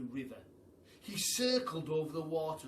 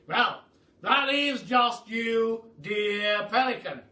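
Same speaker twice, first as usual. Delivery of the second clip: loud, grand aristocratic Duke, higher; booming Duke; 8 semitones higher, 14 dB louder, much slower than usual. Acted. The Duke welcomes the hungry giraffe, booming and grand. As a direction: boom it out like a jolly grand duke.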